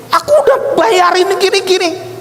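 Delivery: high-pitched protest; indignant complaint. Retold or imitated. Preacher replaying his complaint about having paid already, indignant.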